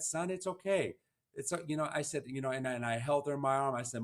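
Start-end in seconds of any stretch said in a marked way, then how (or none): 0.0-0.9 higher, lightly voiced mom comforting her son; a hint of mom calming him, pitched up; mom's gentle reassurance. Mom reassures her son in the hospital, lightly voiced and higher. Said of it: "And she said".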